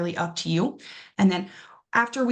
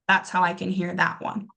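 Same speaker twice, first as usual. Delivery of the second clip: ordinary talk, nothing marked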